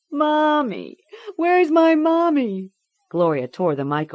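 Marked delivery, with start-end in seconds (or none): 0.1-2.7 put-on very high, loud child's wail; crying for mommy. A narrator's reading. Girl cries out for her mother, high panicked wail. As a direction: call for your mother in panic.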